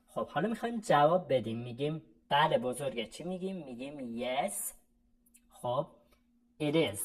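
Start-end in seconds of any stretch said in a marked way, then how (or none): none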